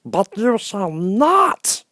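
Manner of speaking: high, very slow Hammerhead threat; slow stern refusal. Acted. Hammerhead blocking the Scarecrow, slow menacing refusal. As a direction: draw it out as a warning.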